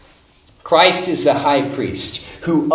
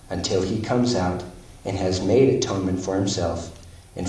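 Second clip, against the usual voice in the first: softer, measured reading of the verse; scripture reading; 6 semitones lower, 4 dB quieter than usual. Acted. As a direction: read it low and steady, like a text. Quiet reading of verse seventeen.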